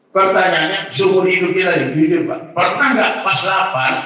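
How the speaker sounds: ordinary delivery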